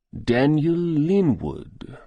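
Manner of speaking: put-on slow, drawn-out Russian-accented recognition; Russian-accented doctor slowly recalling the name. A narrator's reading. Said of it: "He spoke with a thick Russian accent".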